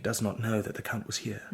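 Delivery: hushed, soft whisper. Acted. Walter and Marian whispering so Laura won't hear. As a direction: keep it secret from Laura.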